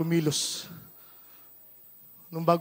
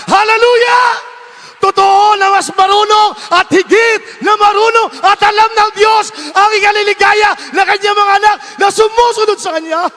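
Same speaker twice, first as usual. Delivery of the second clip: preaching at full force; 15 dB louder than usual. Real, not acted. Pastor exhorting his congregation, full-force preaching. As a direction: hammer each word home to the flock.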